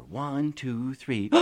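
plain reading voice

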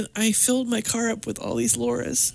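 soft-edged, high, crying voice; tearful. Real, not acted. Client picturing her younger selves in the car, tearful. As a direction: keep going while tearing up. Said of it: "I'm totally crying".

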